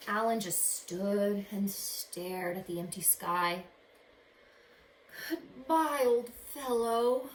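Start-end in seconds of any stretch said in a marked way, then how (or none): 5.3-7.3 slow, louder sad farewell; sad call. Acted. Boy calling goodbye to his flown-away duck, sad. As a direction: call it after him sadly. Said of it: "he called out sadly".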